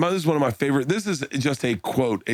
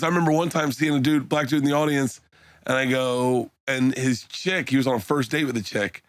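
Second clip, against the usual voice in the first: usual speaking voice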